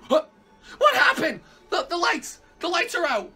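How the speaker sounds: shrill, loud panic as the lights die; panicked high yelling. Real, not acted. Player panicking when the lights go out, yelling high and loud. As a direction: yell into the dark, frantic and high.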